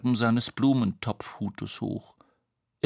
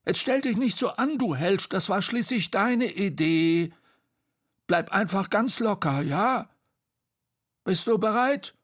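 high, impatient character voice; scold, then coax in a high voice; Bo, bossy; 10 semitones higher, 3 dB louder than usual. Acted. Bo urging reluctant Tete on, high and bossy.

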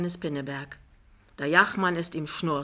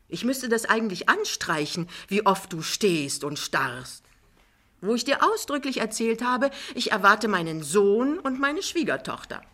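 higher, lightly voiced scolding wife; vorwurfsvolle Frau Pinneberg; 6 semitones higher, 3 dB louder than usual. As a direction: reproach him with swoops in pitch. Frau Pinneberg rügt Jachmann. (vorwurfsvoll, in höherer Stimmlage)

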